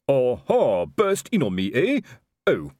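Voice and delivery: loud gloating aha, then deflating; evil triumph. A narrator's reading. Colon gloating as he jumps at the door. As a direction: pounce on the intruder in triumph. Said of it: "Colon to jump up in evil triumph".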